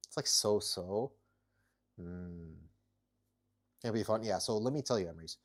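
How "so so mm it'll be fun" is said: normal talking voice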